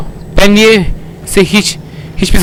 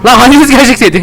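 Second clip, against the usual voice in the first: ordinary delivery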